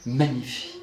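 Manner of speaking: "Magnifie" said softly and broadly; wide articulation. Shown as a demonstration. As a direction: open the lips wide through "Magnifie". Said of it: "c'est très large".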